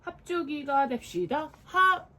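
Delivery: slow build to a high, loud final yell; rally the kids with a big shout; sudden shout. Acted. Dad shouting to start a game with the kids, slow then very loud. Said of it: "아빠가 갑자기 소리쳤어요".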